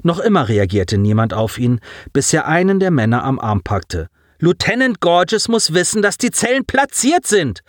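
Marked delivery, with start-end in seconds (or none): 4.4-7.6 high, urgent yell without full volume. A narrator's reading. Boy into a soldier's face, urgent high-pitched yell. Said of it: "brüllte er ihm ins Gesicht".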